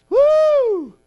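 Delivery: high, loud joyful woo; ecstatic whoop. Acted. Preacher whooping to the church in joy.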